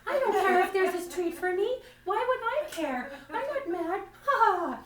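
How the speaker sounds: high, bright forced denial with a fake laugh; fake not-mad. Comic hiding her anger over the empty cupboard, forced high denial. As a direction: chirp it up high, hiding the fury. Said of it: "I have to pretend like I'm not mad".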